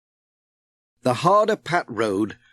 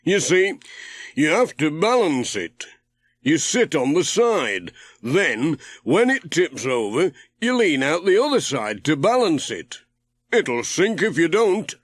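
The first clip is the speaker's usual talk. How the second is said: Ted, voiced higher; 5 semitones higher than usual. A narrator's reading. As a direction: call to Pat from the boat, curious. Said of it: "said Ted".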